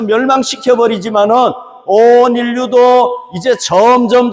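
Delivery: fiery preaching. Acted. Preacher warning the congregation of final judgment, fiery. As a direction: build warning to a full pulpit cry.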